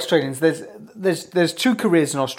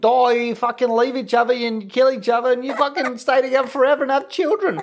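higher, ranting Aussie bloke; Australian accent; 6 semitones higher than usual. Acted. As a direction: build into a high-pitched Australian rant. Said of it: "you love the Aussie accent".

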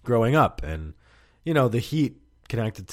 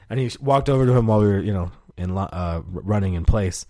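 ordinary delivery

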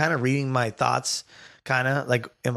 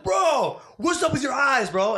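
shouting in a hammed-up panic; mock shout; 13 semitones higher than usual. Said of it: "So I was like".

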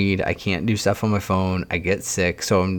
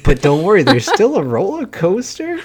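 fake cheerful parent; 10 semitones higher, 5 dB louder than usual. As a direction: sell the letdown with a bright parent voice. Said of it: "then they're like".

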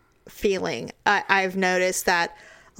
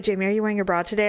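fast routine mom check; rattled-off mom question; much faster than usual. Retold or imitated. Mother checking her daughter each morning, fast. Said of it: "she'd be like".

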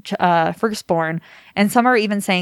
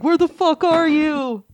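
annoyed friends; 9 semitones higher, slower than usual. Retold or imitated. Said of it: "people are like".

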